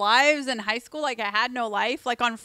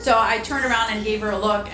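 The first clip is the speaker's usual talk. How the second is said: normal talking voice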